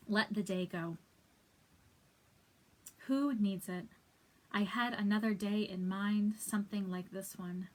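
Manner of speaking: spaced-out, deliberate verse reading; poem recitation. Acted. Reader sharing a comforting last poem, deliberate recitation. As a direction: set each short phrase apart with a pause.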